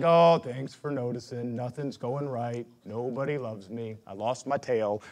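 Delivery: low, slow, mopey donkey; sulky Eeyore moping. Retold or imitated. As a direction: droop every word like Eeyore. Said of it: "I can get a lot like".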